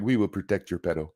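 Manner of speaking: a hint of a French accent, low and soft; lightly put-on French accent, said quietly; mock French accent. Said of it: "That's my best French accent".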